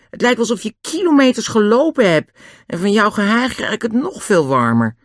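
high, lightly voiced girl's complaint; complaining Annie. Annie complains about the dog's panting, exasperated. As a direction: start high in exasperation, then ease down.

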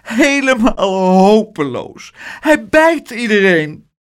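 high, wailing tearful complaint; sobbing despair. A narrator's reading. Aunt despairing to Pluk about the biting, high sobbed wail. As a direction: lament it as if at wits' end. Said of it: "snikte ze".

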